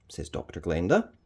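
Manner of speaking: usual speaking voice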